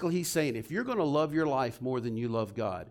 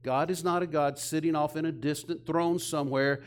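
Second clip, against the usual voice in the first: ordinary delivery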